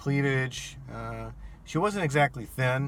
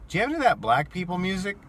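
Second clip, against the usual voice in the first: a hint of a tipsy woman, higher and quick; lightly voiced drunk passenger; 9 semitones higher, 4 dB louder, much faster than usual. Drunk rider asking the driver for music, lightly mimicked tipsy voice.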